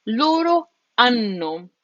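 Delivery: slow LORO HANNO, silent H, long held N; double N. Shown as a demonstration. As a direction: hit both Ns in HANNO, "an-no". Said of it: "hit those two N's twice".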